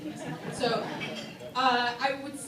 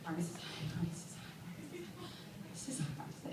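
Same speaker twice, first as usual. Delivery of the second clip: flustered mumble; 5 semitones lower, 17 dB quieter, faster than usual. Retold or imitated. Tongue-tied near Oprah.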